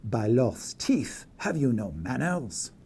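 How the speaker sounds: put-on slow, indignant French-accented scolding. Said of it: "in a French accent".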